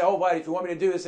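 very fast, low throwaway offer; token courtesy. Acted. Preacher mimicking people asking God as courtesy, hurried throwaway. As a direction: rattle it off as a mere formality. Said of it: "saying it as a courtesy".

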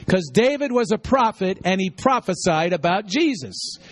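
ordinary delivery

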